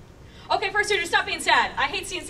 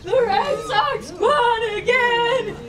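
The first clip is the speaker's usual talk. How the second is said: put-on higher, louder, slow drunken cheer; drunk Boston fan; 7 semitones higher, 5 dB louder, slower than usual. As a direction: bellow it like a boozy Red Sox fan. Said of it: "'cause they're like".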